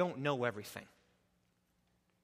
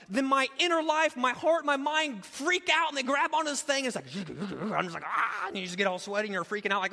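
loud, higher, driving sermon voice; fired-up pastor in full swing; full-force preaching; 9 semitones higher, 8 dB louder than usual. Acted. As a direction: preach it out to the back row.

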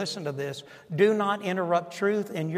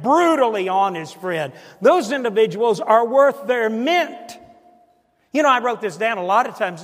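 louder, higher preaching, stretching key words; full-force preaching; 4 semitones higher, 8 dB louder than usual. Acted. Preacher urging the church toward brutal friends, full force. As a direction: press the point hard from the pulpit.

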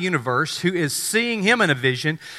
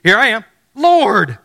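eager servant; 6 semitones higher, 8 dB louder, slower than usual. Acted. Ananias answering God eagerly.

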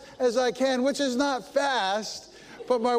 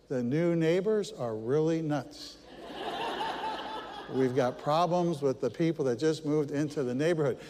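lower, softer, with a laugh, gossiping; gossiping neighbor; 6 semitones lower, 5 dB quieter than usual. Retold or imitated. Neighbor at window judging newcomers, lower and softer with a laugh. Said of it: "they say something like this".

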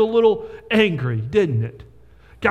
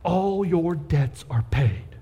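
slow, tender; 3 semitones lower, slower than usual. Shown as a demonstration. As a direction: say it gently, like a gift. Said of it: "That's what he's doing".

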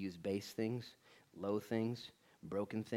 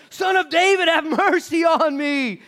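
very high, full-force yell, stretched at the end; crying out louder; 19 dB louder, slower than usual. Acted. Blind beggar begging Jesus for mercy, crying out loudly. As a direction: bellow the plea over the crowd. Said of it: "he cried out all the more".

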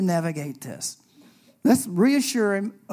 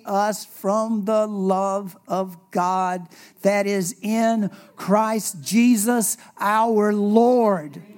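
slow emphatic; 3 semitones higher, 5 dB louder, much slower than usual. Acted. Climax of scripture reading.

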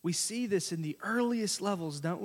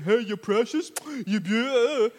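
louder, higher mock sweet dad voice; kids imitating daddy; 4 semitones higher, 8 dB louder than usual. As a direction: ape daddy's tender lines, hammed up. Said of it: "And they're just going".

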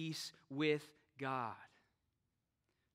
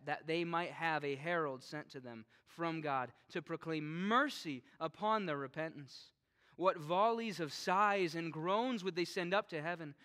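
fervent preaching; 4 semitones higher, 8 dB louder than usual. Real, not acted. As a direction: build the warning with fire. Preaching at full force.

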